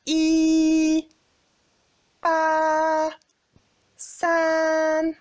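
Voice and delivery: "I, pa, san" each held high, flat and long; high level tones, loud and sustained; first tone. Shown as a demonstration. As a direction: hold "I", "pa", "san" on one high level note. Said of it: "un ton plat et long et aigu".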